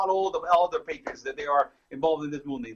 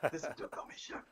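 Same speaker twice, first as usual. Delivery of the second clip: whispered, much softer mock host voice; imitating Duke; 17 dB quieter than usual. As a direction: breathe it like a hushed imitation of the host. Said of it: "I can do a great impersonation".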